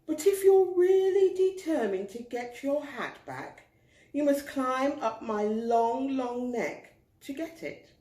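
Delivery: high, lofty giraffe voice; haughty giraffe. Acted. Giraffe keeping the hat, high and lofty. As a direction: look down your nose at the pig. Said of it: "she said".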